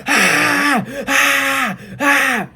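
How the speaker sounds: high loud mock screams; three piercing mock shrieks; screaming. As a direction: shriek in shock three times. Reenacting the scream at kiss.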